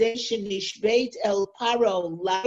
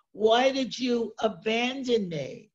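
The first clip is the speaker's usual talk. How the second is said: usual speaking voice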